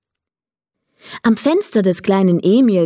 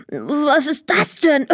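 higher voice spluttering into a cough; coughing, astonished Emil; 7 semitones higher than usual. A narrator's reading. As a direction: choke on dust while asking in surprise. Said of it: "rieb sich die Augen und hustete".

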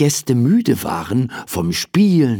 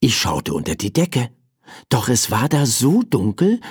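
ordinary delivery, nothing marked

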